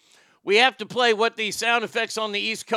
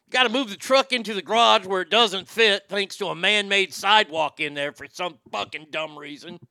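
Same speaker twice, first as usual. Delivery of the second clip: normal talking voice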